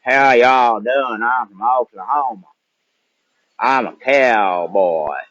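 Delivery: slow, stretched-out Oklahoma cowboy drawl. Acted. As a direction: put on an Oklahoma cowboy accent. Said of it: "quite a drawl to your, your accent".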